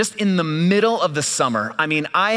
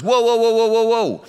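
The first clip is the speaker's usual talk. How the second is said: higher, louder startled whoa whoa; 7 semitones higher, 5 dB louder than usual. Retold or imitated. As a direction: throw up the brakes in sudden alarm. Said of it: "And he just goes".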